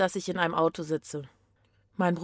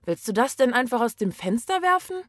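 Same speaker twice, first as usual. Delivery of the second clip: ordinary delivery, nothing marked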